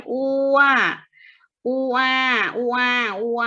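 "อัว" held long, gliding from oo to a; vowel demonstration. Shown as a demonstration. Teacher has pupils pronounce the vowel, long gliding "อัว". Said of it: "นักเรียนออกเสียงอัว".

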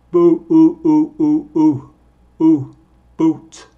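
Bu, u, u said loud, long u held; isolated vowel drill. Shown as a demonstration. Teacher drawing out the u sound, loud. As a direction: stretch the u of boot. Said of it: "con énfasis en el vocal".

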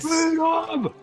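high, drawn-out mock war cry; hammed-up heroic yell; Braveheart battle cry. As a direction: shout it like a rallying cry.

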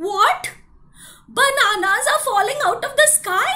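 loud, high, amazed; astonished postman. Acted. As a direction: shout up at the sky in disbelief. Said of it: "looked at the sky and said".

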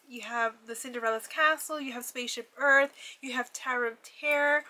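usual speaking voice

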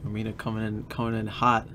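ordinary delivery, nothing marked

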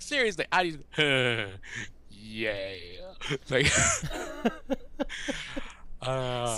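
words breaking into real laughter; can barely get it out for laughing. Real, not acted. The fan after the photo, laughing too hard to talk.

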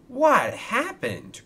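high, drawn-out exclamation; alarmed cry. A narrator's reading. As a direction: cry it out slowly in shock. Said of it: "cried Kevin".

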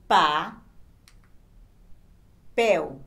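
loud, separate "Pa", long pause, "pel"; each syllable set apart and projected. Shown as a demonstration. Teacher showing two sets of sound, syllables apart. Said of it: "Aqui nós temos dois conjuntos de som".

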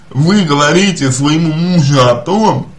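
ordinary delivery

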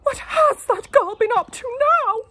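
very high, loud, gasping question; gasping wife. A narrator's reading. Wife suspecting her daughter, high and loud. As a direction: gasp it out, scandalised. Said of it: "she gasped".

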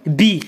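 بي as one syllable, "bee"; B plus I. Shown as a demonstration. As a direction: join b straight into an i in بي. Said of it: "مع إي كيتقرا بي".

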